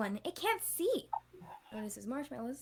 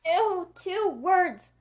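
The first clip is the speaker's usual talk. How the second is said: disgusted; 7 semitones higher, 8 dB louder, much slower than usual. Acted. Disgusted at dog breath.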